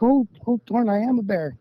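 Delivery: high, startled oh-oh at his own fur; mock surprise. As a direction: act out catching yourself as a bear. Realizing he has become a bear.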